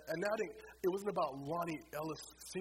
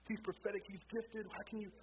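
anguished crying; 7 semitones higher than usual. Retold or imitated. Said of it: "I cry and I weep".

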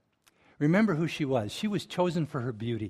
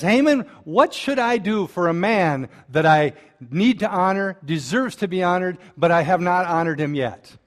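louder, higher, pressing question; eager king; 5 semitones higher, 8 dB louder than usual. Retold or imitated. King asking Haman, loud and eager. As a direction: lean in and demand an answer.

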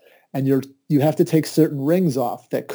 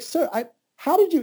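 much higher, squeaky amazement; astonished. Retold or imitated. To the rabbi, stunned squeaky disbelief. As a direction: gasp it out, stunned.

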